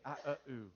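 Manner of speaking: "I, uh, ooh" soft and low, vowels only; a quiet childlike "I, uh, ooh" for "I love you"; imitated child's attempt. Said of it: "As a child, learn to say".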